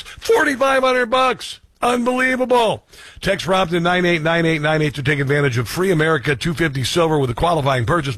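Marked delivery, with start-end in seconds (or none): 0.0-2.8 high, slow, incredulous "Forty-five hundred bucks"; astonished. Real, not acted. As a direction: spell out the price in disbelief. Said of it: "Today, it's forty-five hundred bucks".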